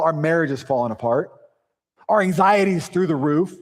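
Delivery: usual speaking voice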